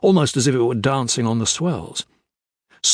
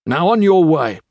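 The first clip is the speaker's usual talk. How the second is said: sharp, louder British order; curt dismissal; 6 semitones higher, 6 dB louder than usual. A narrator's reading. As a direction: send him off curtly. Officer dismisses the old sailor.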